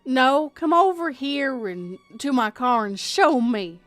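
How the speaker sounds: pushy Southern stranger losing patience; aggressive and annoyed. Acted. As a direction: push it as an irritated demand in a Southern accent. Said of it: "a little aggressive and annoyed".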